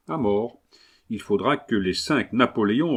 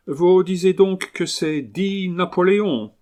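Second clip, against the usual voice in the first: higher-pitched Englishman speaking broken French; English accent; 8 semitones higher, 4 dB louder than usual. Acted. Englishman haggling over his new dog, English accent. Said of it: "l'accent anglais".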